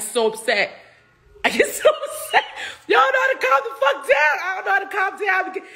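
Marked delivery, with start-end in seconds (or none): 1.4-2.4 squeaky high laughing cry of upset; laughing mock upset. Shown as a demonstration. Telling the crowd how upset she gets, high and laughing. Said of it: "My temper's nuts". 2.9-5.6 mock temper shout. Showing the audience her temper, high mock shouting. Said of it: "My temper's nuts".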